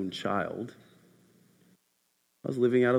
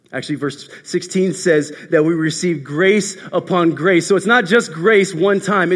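loud, raised, rhythmic sermon delivery; full-force preaching; 8 semitones higher, 7 dB louder than usual. Real, not acted. Preacher proclaims grace upon grace to congregation, fervent preaching. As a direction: build the repetitions with rising fervour, then ease down.

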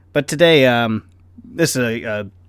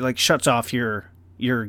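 ordinary delivery

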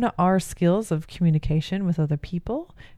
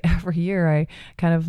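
normal talking voice

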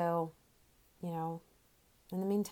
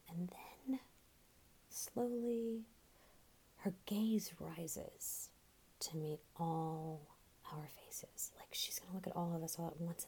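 slow, soft, creeping whisper; eerie whisper; 2 semitones lower, 11 dB quieter than usual. Acted. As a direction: draw them in with a spooky hush. Ghost story climax, hushed.

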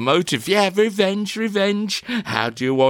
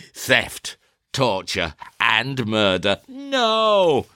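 ordinary talk

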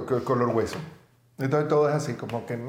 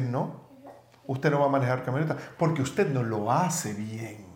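mock stern refusal; 7 semitones lower, 5 dB quieter, slower than usual. Husband voices his stern reply.

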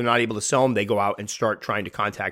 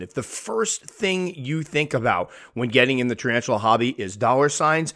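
normal talking voice

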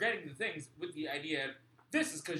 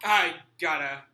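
loud and slow; 13 dB louder, slower than usual. Real, not acted. Cutting in on the others.

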